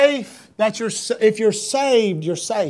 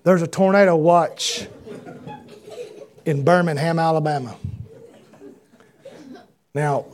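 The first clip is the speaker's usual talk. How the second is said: low, deadpan weatherman gravity; daddy's storm forecast; 5 semitones lower than usual. Retold or imitated. Son mimicking daddy tracking storms at supper, low and grave. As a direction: drop low and forecast doom. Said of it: "if the clouds turned".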